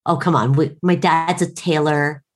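normal talking voice

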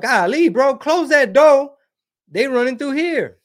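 high, loud alarmed call to shut the door; panicked warning. Retold or imitated. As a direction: yell it like they're running through the yard. Voicing panicked people in Mexico.

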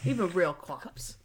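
Frankie voice. Retold or imitated. Host repeats the clip to prove the Frankie voice, mimicked accent. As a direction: draw it out in a put-on Frankie accent. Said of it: "he was in Frankie voice".

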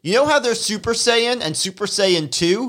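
high, breezy laughing Goku; laughing high Goku impression. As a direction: toss it off like a cheerful know-nothing. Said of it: "I just love him going".

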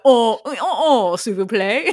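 fake French accent. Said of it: "putting on a fake French accent".

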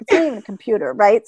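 usual speaking voice

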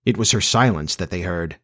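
ordinary delivery, nothing marked

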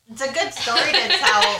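high, loud, laughing through the words; laughter breaking into the sentence; choking on a laugh. Real, not acted. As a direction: try to talk while choking with laughter. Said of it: "She choked on her laugh".

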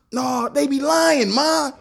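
put-on kid voice. Imitating cartoon kid.

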